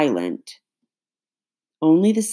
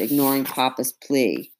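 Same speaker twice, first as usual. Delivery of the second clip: plain reading voice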